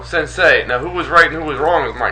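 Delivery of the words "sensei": sensei said as sen-SAY; corrected sensei. Shown as a demonstration. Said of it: "No, no, no, no".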